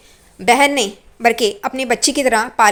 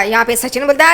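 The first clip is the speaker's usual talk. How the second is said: ordinary delivery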